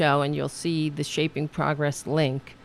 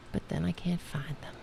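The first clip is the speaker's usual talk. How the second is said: normal talking voice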